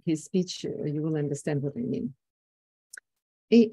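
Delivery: ordinary talk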